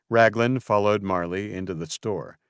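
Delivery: plain reading voice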